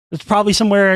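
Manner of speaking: ordinary delivery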